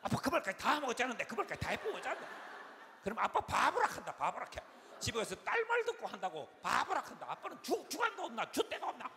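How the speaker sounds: high, quick, loud scolding; berating daughter. Retold or imitated. Second daughter berating dad after his sermon, worked up. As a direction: imitate a daughter ranting at dad.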